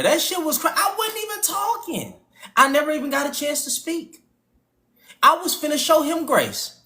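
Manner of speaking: lightly hammed-up upset man; mocking a crashing-out caller.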